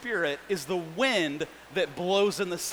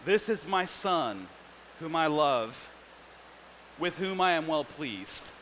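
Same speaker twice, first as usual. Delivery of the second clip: tender Father; 4 semitones lower, 5 dB quieter than usual. Retold or imitated. Preacher voicing God's words over his son, tender and soft. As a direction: say it gently, like a father's love. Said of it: "and a voice says".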